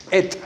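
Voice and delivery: "et" said as a short open e with a crisp final t; Hebrew pronunciation. Shown as a demonstration. Teacher showing the class how to say "et", short e with a crisp final t. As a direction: say "et" as one short syllable, e then a clear t. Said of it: "esta palabra se pronuncia como".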